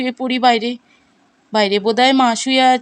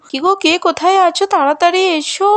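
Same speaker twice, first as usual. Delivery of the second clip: calling out; 7 semitones higher than usual. Acted. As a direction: shout across the house for everyone. Calls the household for help.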